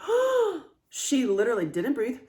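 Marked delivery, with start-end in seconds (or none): 0.1-0.6 high startled oh, pitch jumps up; sudden high gasp of realization; shocked oh. Retold or imitated. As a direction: catch your breath and squeak it out. Reacting to grandmother's silence.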